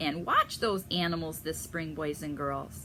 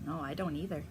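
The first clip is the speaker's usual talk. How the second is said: plain reading voice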